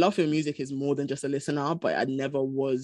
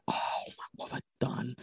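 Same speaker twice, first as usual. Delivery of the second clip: panicked whisper; 2 semitones lower, 10 dB quieter than usual. Retold or imitated. Retold panic in her room.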